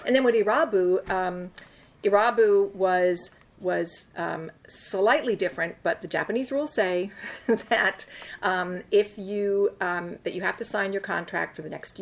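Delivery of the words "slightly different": ordinary delivery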